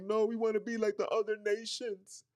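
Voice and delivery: high mocking whine of the people; whining cowards. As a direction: mimic the nation whining for a king. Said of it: "we was crying like".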